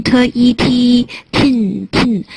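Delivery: slow "tin" with a long i and full final n. Shown as a demonstration. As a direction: draw out the vowel in "tin". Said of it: "它那个一比较长".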